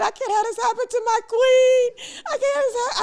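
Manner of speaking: high, loud wailing imitation; hammed-up tearful wail, stretched out; mock crying. Imitating makeup artist upset for her queen, mock sobbing. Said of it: "She starts crying".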